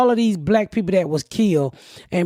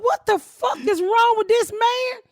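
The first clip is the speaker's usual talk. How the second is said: high, slow exasperated shout; frantic and high, drawn out; panicked neighbor; 12 semitones higher than usual. Retold or imitated. Neighbor yelling at the sheriff, high and slow.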